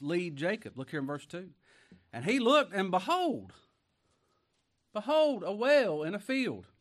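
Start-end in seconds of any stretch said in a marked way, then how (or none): 2.1-6.7 high, loud, ringing behold; full-force scripture reading. Real, not acted. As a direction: proclaim the verse to the whole room.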